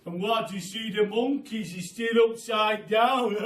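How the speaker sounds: loud, high gloating voice; Mister Twit. A narrator's reading. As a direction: sneer at the monkeys.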